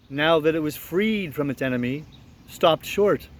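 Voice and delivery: ordinary delivery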